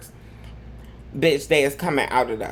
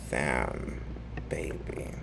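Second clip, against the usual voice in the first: whisper; 13 semitones lower, 12 dB quieter, much slower than usual. Real, not acted. Whispered sign-off to prayer.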